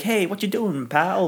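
a hint of a wisecracking toon; Bugs Bunny. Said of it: "Bugs Bunny".

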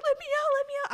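very high, loud mock terror; panicked plea. Little sister trapped in the bathroom begging, high frantic plea. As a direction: beg to get out, half crying. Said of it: "I'm, like, crying".

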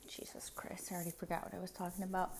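fast, voiceless muttered aside; tired whisper. Real, not acted. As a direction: whisper it quickly to yourself, worn out. Exhausted voiceless aside to herself.

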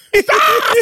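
squealing high laugh; high shrieking laugh. Real, not acted. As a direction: burst into a shrill cackle. Mocking A-Rod's bad call.

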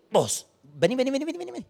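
a touch of a pastor's insistent call, rising; calling someone over. Retold or imitated. Pastor calls the shy young servant over, insistent. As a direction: beckon him across the room.

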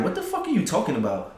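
soft, rising then falling incredulous question; retold incredulous challenge. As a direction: quietly challenge her, baffled. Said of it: "Like, just like that".

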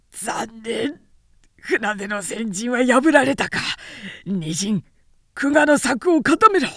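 rally the second line in alarm; frustrated general. Acted. General Chen Wu ordering the second line, urgent shout.

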